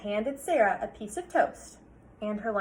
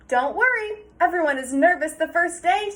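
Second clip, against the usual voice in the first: higher, louder upbeat comfort; reassuring principal; 6 semitones higher, 8 dB louder than usual. Acted. Principal reassuring Sarah over her shoulder, high and upbeat. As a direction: soothe her nerves briskly.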